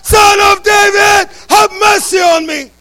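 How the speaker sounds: a blind beggar shouting for mercy over the crowd. Acted. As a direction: cry out loud for Jesus to hear you. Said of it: "he continued all the more".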